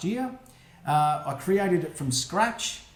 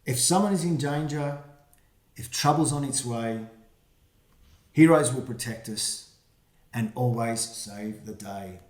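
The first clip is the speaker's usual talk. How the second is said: put-on reading to children; 3 dB louder than usual. Reads rhyming picture book aloud.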